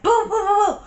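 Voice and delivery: imitated glass shattering. Showing how glass just shatters, imitated booms. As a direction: rattle off booms like glass bursting. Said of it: "It sounds like ruckus".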